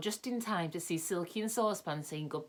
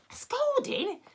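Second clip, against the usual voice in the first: a squeaky, much higher indignant "A scolding?"; shocked question; 4 dB louder, slower than usual. A narrator's reading. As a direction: shriek it in outrage. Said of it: "said Dame Washalot's voice".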